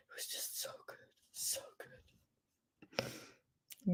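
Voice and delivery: breathy hush, savoring the memory; whispered. Real, not acted. Remembering the opening of the show, an awed whisper. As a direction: let it fall to a whisper of awe.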